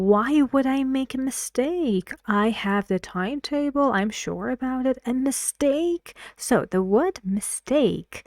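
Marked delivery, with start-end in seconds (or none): 0.1-5.0 higher-pitched, incredulous objection; acted astonishment at being corrected; surprised protest. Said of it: "the surprising part". 5.1-6.1 high "A mistake" with the stressed end swung up; surprised question, much higher, rising through "mistake"; rising intonation. Shown as a demonstration. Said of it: "the rising intonation goes up".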